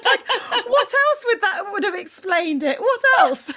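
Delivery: high, loud, through real laughter; hysterical, incredulous admission; laughing confession. Real, not acted. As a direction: squeal it out helplessly amused. Laughing as she confesses her lie.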